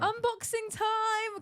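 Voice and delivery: high, drawn-out sing-out; excited announcement. Real, not acted. Co-host launching the package opening, excited high announcement. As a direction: announce it like a big event, stretched and high.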